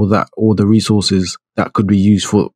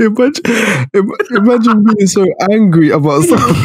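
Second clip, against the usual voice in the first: high-pitched, talking through laughter; real giggles breaking into the words; laughing; 12 semitones higher than usual. Real, not acted. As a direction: barely get it out for laughing. Cracking up at gym protest story.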